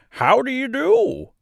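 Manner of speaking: high, slow scarecrow question. A narrator's reading. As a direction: ask it as a cheerful straw man. Scarecrow asks.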